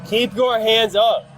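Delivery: commanding shout. Real, not acted. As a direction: order him firmly, word by word. Officer commanding armed suspect.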